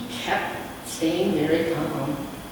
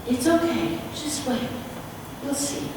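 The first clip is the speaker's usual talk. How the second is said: higher, soft, soothing reply; gently calm the frightened people; calm reassurance; 7 semitones higher, 5 dB quieter than usual. A narrator's reading. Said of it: "And so he said".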